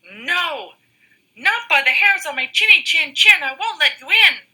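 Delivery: high, squeaky pig voice; squeaky little pig. Acted. First little pig refusing the wolf, high and squeaky. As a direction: squeak it out defiantly. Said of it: "squeaked the second little pig".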